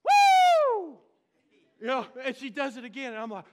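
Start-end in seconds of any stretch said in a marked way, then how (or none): 0.0-1.0 very high, loud joyful whoop; grandma's whoop. Retold or imitated. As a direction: whoop like grandma jumping up in church. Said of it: "she goes".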